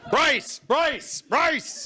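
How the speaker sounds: lightly voiced brace order; mock brace call. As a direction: call it like a cabin crew alarm. Internal crew bracing.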